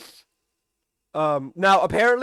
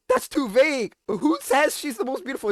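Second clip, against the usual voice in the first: lightly voiced, higher indignant challenge; Mike's voice; 8 semitones higher than usual. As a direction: poke holes in the wish, a bit higher. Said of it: "Mike is like".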